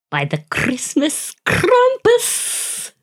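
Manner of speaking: slow, high, drawn-out Krampus; spooky reveal. Acted. Storyteller unveiling Krampus to co-host, slow and high. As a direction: unveil the villain with mock menace. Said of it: "dramatic reveal of Krampus".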